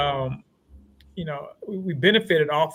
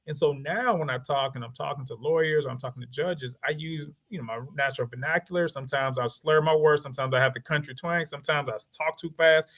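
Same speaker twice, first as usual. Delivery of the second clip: ordinary talk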